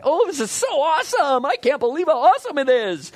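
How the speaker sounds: high, loud, giddy mock delight; high, loud and giddy, rushed a little on the second line; mock gushing Jonah. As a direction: gush like a silly overexcited kid. Mocking Jonah gushing over plant.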